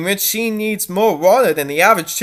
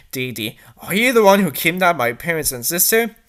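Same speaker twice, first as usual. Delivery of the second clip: ordinary delivery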